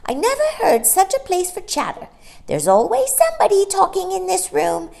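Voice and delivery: high, loud scolding bird voice; shrill starling. Acted. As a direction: squawk it like a cheeky starling. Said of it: "said a shrill voice at the window".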